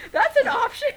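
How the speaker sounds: high, loud laughing disbelief; amazed disbelief. Retold or imitated. Fan amazed at being offered a photo, high loud laughing disbelief. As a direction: squeak it out in amazement.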